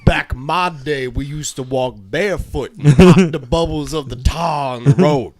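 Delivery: loud, hammed-up Southern old-timer; old-timer southern accent. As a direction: reminisce loudly in a Southern accent.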